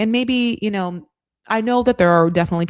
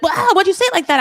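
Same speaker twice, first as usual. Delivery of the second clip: much higher, loud accusing squawk; mock outraged shriek; 13 semitones higher, 7 dB louder, much faster than usual. [name] confronting Michael for telling Beer Can, loud and high. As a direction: fire it at him like a betrayed friend.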